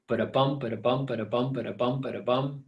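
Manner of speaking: "Ba-da-bum" chanted with the beat on "bum"; galloping horse rhythm. Shown as a demonstration. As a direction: gallop evenly through each "ba-da-bum". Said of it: "sort of a galloping horse sound".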